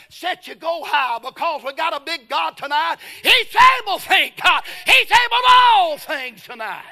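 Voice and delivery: shouted, high-pitched preacher's climax; preaching at full force. Real, not acted. Preacher shouting to the church that God is able. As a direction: belt it out to the congregation.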